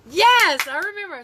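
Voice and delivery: high, loud burst of recognition; excited realization. Real, not acted. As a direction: exclaim it as it suddenly clicks. Sudden recall of Pig Latin rule.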